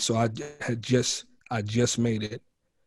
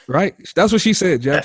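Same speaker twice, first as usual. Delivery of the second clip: ordinary talk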